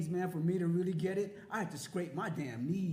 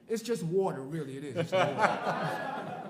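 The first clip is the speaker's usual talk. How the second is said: laughing it off; 7 dB louder than usual. Real, not acted. Laughing after coughing on water.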